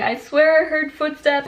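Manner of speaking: high-pitched frightened insistence; imitated scared brother. Brother reporting footsteps to police, imitated panic. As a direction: mimic a panicky kid reporting an intruder. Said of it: "he's on the phone and he's saying".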